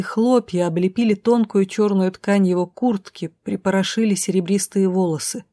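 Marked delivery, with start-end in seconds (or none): none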